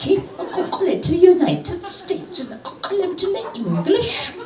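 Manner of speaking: put-on very high, prim English-accented nun mangling the native language; Oxford-accented nun. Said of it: "this is the way she spoke".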